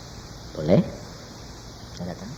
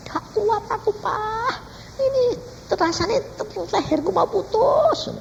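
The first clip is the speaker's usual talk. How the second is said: high, strained wail without much volume; mock agonized high voice; retold pained cry; 3 dB quieter, slower than usual. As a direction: imitate someone crying out in pain. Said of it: "Dia teriak-teriak".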